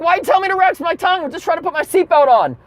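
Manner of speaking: high-pitched, baffled mock complaint; exasperated protest. Confused passenger protests to doctor, acted exasperation.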